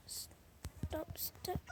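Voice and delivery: frightened whispered stop; whispering. Real, not acted. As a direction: hush it as the monster nears. Monster chasing in the game.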